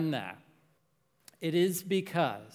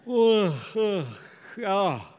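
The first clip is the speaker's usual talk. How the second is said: yawn; 3 semitones higher, 5 dB louder than usual. Real, not acted. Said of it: "oh, hold on".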